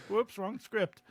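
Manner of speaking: words broken up by laughter; laughing. Real, not acted. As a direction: concede it while still laughing. Laughing along at the teasing.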